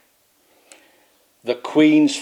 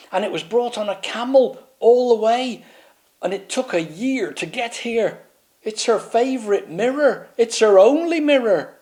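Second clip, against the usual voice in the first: higher, rising worried knight voice; a pompous knight getting more and more flustered; fretting knight; 8 semitones higher than usual. Acted. Pompous knight fussing to the others, higher and worried. Swaggeralot frets over broken mirror.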